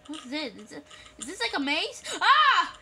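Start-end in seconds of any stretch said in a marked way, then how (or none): 2.2-2.8 sudden loud, high yelp; startled scream. Real, not acted. Player startled in the haunted house maze, screaming. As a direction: cry out at a jump scare.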